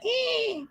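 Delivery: tiny high baby grunt, barely voiced; imitated baby grunt. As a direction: mimic the baby's little fussy noise. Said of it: "He's like".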